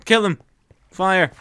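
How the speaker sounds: high, loud command; urgent shout. Real, not acted. Player urging friend against creepers, urgent shout. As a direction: call out orders in a fight.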